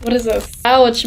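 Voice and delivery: ordinary talk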